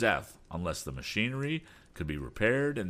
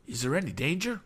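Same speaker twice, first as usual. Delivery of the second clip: higher-pitched worried question; mildly alarmed, pitch raised; perturbed; 9 semitones higher, faster than usual. A narrator's reading. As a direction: ask it with mounting unease. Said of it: "in a perturbed voice".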